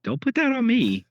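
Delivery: high, loud indignant protest. Real, not acted. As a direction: cry out in mock outrage. Protesting a joke blame.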